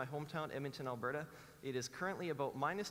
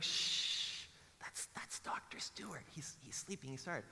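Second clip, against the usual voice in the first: hushed shushing; 3 dB quieter, slower than usual. Retold or imitated. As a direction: hush a child near a sleeper. Hushing daughter near sleeping guest.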